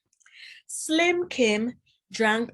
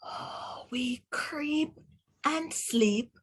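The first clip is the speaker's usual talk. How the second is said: soft half-whisper that starts breathy; hushed creeping; 7 dB quieter, much slower than usual. Acted. Teacher reading softly to children as creatures creep. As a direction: creep in on tiptoe with the words.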